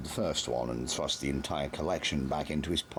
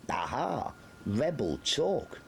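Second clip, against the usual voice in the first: much higher, slow gloating; triumphant sergeant; 9 semitones higher, slower than usual. A narrator's reading. Carter pounces on Nobby's answer, gloating. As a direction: pounce on the words.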